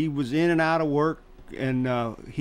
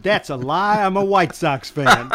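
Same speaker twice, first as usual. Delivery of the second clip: laughing as he quotes his comeback, louder; half-laughed, higher and louder; laughing retort; 5 semitones higher, 6 dB louder than usual. Retold or imitated. Retelling his childhood comeback to a friend, laughing.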